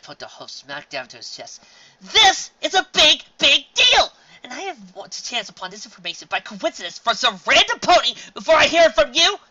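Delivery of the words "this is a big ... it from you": high, loud outraged shouting; outraged yelling. Acted. Sister yelling at her brother over the secret, outraged. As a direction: blast him with indignant fury.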